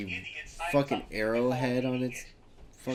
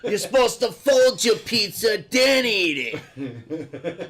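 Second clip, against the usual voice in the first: laughing shout; 7 semitones higher, 3 dB louder, slower than usual. Pretend. Mock instruction, shouted with laughter.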